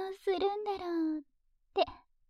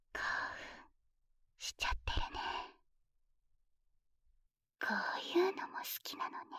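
whispering; 4 semitones lower than usual. Acted. Binding partner, whispering close.

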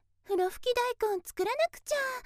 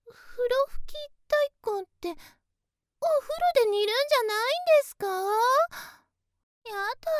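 stammered, higher-pitched surprise; flustered, incredulous question; startled disbelief; 5 semitones higher, 4 dB louder, much faster than usual. Acted. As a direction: blurt it out as your mistake dawns. Learns her mistake about the dish.

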